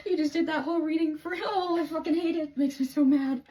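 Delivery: high, loud mock of her own ranting; imitating herself whining loudly, mocking; God's mocking voice. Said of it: "God, like, telling me".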